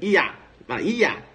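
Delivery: "Ia" as one punched syllable; first syllable drill. Shown as a demonstration. Teacher breaks the name into parts, syllable drill. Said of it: "Como assim, professor?".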